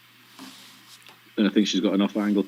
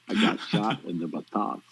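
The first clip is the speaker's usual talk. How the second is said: slow, drawn-out American Southern drawl; a better take on the Forrest Gump quote, in a slow Southern drawl; Forrest Gump impression; 4 dB quieter, slower than usual. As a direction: copy Forrest Gump slowly and earnestly. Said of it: "You actually do that much better".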